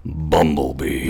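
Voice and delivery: deep, slow robot commander voice; Optimus Prime voice. Pretend. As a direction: drag out Bumblebee like a big robot leader. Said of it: "Optimus Prime impression".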